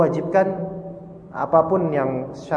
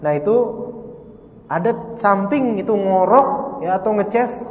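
ordinary delivery

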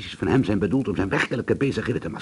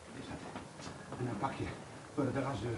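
whisper; 17 dB quieter than usual. Acted. Hushed discovery of footprints.

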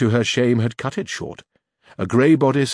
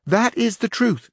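page; 12 semitones higher, slower than usual. A narrator's reading. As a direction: voice the page lifted high. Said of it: "said the page".

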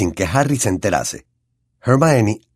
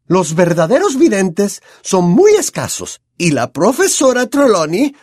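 lightly voiced stern teacher, higher; a hint of a strict, impatient professor lecturing; measured stern schoolmistress, higher pitched; Professor McGonagall; 11 semitones higher, 4 dB louder than usual. A narrator's reading. McGonagall voice in the story.

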